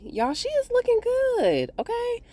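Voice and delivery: high, excited gushing; thrilled high praise. Real, not acted. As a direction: squeal over how good she looks. Gushing over actress's looks.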